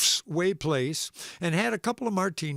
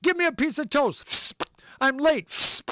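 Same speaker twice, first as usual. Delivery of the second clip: put-on higher and louder, hurried kids shouting; rushed children shouting over breakfast; yelling kids; 9 semitones higher, 3 dB louder than usual. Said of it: "the kids came running in yelling".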